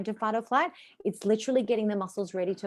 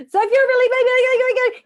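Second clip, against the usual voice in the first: much higher and louder hammed-up ad yell; over-the-top American commercial voice; mock TV commercial; 13 dB louder, faster than usual. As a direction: blast it like a cheesy old TV ad. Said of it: "the old-fashioned American T V commercials".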